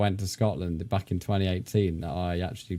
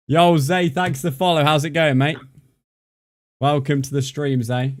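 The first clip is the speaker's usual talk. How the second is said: loud excited welcome; 5 semitones higher, 6 dB louder than usual. Real, not acted. Welcoming a new follower loudly.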